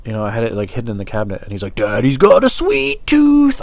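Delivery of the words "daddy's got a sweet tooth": high, slow, stretched screech; drawn-out falsetto caricature; exaggerated creepy daddy voice. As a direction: screech it like a movie monster. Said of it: "he didn't actually say it like that".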